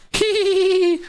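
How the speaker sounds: high demonic cackle; menacing laugh. Shown as a demonstration. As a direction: cackle like the devil. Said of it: "my laugh sounds more like this".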